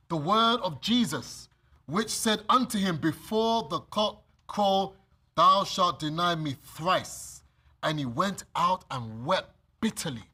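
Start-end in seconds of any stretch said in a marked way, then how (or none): 3.0-7.4 slow solemn. Acted. Solemn quote of Jesus.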